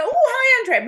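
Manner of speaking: high, loud mock cheery hello; cheery greeting. People greeting her on the street, high and loud. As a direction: chirp the greeting like an eager fan. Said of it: "people always go".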